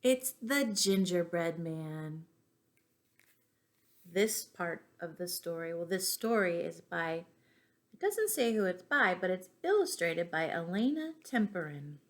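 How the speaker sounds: usual speaking voice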